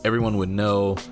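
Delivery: ordinary talk, nothing marked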